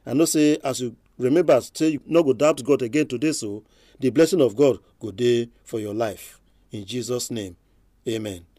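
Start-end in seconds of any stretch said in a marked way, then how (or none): none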